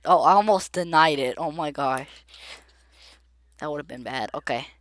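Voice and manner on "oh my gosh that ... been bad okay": ordinary talk, nothing marked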